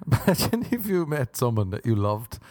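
ordinary delivery

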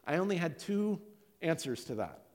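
ordinary talk

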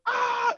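high, loud shriek of joy, a mock yell; excited scream. Hearing the job offer, a joyful shriek. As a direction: let out a short ecstatic shriek. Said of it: "I went".

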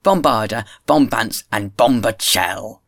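loud, ringing heckle; boisterous acted cry. A narrator's reading. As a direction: call out each word forcefully, stretching the last. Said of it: "cried Listolier".

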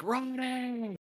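high, stretched cry of a name; mock anguished call. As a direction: call after a friend being taken. [name] calls out to Ronnie.

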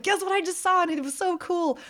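put-on high, eager child's voice; bursting to tell mom the news; excited little girl. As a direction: kid rushing in with news.

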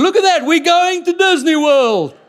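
very high, loud, stretched-out cheer; overjoyed shouting. Acted. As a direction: yell it in hammed-up jubilation. Acts colleague thrilled by free trip.

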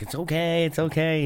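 high, soothing mother voice; calm a scared child; soothing kids. Retold or imitated.

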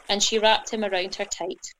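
plain reading voice

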